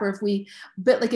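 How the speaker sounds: ordinary talk, nothing marked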